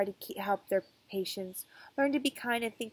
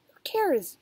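grumbling; 8 semitones higher, 7 dB louder than usual. Acted. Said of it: "grumbled Kathy".

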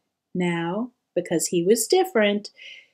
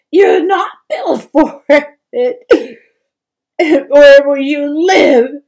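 loud, higher, crying plea; tearful pleading; 4 semitones higher, 9 dB louder than usual. A narrator's reading. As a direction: sob it out, voice breaking. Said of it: "she sobbed".

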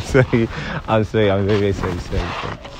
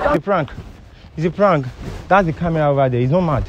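high-pitched, placating explanation; hurriedly defuse the anger in a raised pitch; urgent, higher-voiced pleading; anxious reassurance; 7 semitones higher than usual. Real, not acted. Hurried reveal to calm woman.

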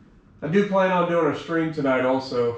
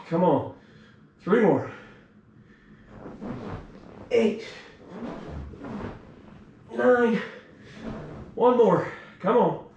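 straining effort; 6 semitones higher than usual. Real, not acted. Pushing through final reps.

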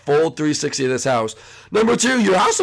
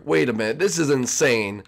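usual speaking voice

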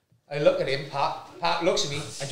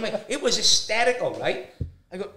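ordinary talk

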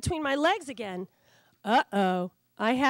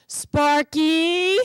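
stern call; 5 semitones higher, 7 dB louder than usual. Acted. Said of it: "I can only understand my name".

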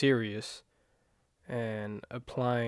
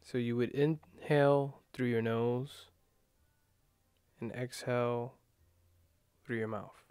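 guided meditation. Acted. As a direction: ease them into calm breathing, gently.

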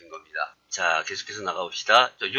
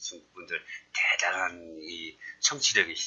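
whispered aside; 3 semitones lower, 4 dB quieter than usual. Real, not acted. Teasing aside about Chinese listeners.

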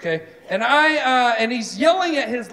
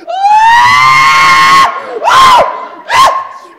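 high, loud panicked screams; panicked screaming; 13 dB louder, much slower than usual. Retold or imitated. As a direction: shriek like something grabbed your leg. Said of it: "I'm just like".

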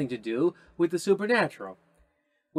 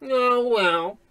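higher, brassy cop voice; brash police detective; 8 semitones higher, slower than usual. Acted. Detective Matthews clearing the crime scene, brash. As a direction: swagger in and take charge.

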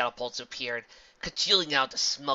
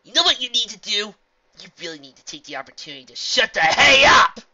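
loud, higher mocking shout; defiant shout; 9 semitones higher, 17 dB louder than usual. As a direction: yell it right in her face. Rainbow defiantly taunts villain.

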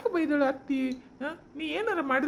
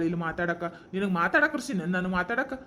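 ordinary talk

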